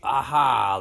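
ordinary delivery

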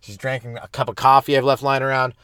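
ordinary talk, nothing marked

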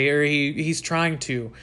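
ordinary talk, nothing marked

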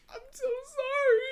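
apology squeaked way up high; high squeaky apology. Retold or imitated. Apologizing to her friends in a high squeaky laugh. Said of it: "I said".

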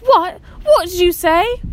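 loud, high, amazed cry. A narrator's reading. Adil from the kitchen door, loud and amazed. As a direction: burst out in astonishment. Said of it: "a look of comical amazement".

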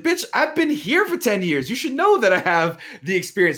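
high, fed-up outburst at the boss; indignant retort. Retold or imitated. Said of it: "but he's like".

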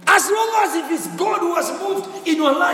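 preaching. Real, not acted. Preaching at full force.

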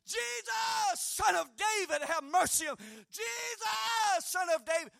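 high desperate shout for mercy; crying out. Acted. Blind beggar shouting to Jesus over the crowd, desperate shout. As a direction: shout it out high, begging to be heard. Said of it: "he started crying out".

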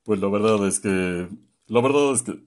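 overdone grave, loud voice; exaggerated deep voice. Shown as a demonstration. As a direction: push the voice too deep on purpose. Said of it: "tampoco exageres".

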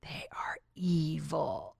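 slow, breathy conspiratorial whisper. Retold or imitated. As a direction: whisper it like a dark secret. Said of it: "she's like".

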